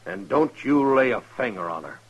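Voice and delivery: loud, menacing order; furious warning to leave her alone; angry threat. Acted. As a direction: bark the threat at him. Foss threatens the brother.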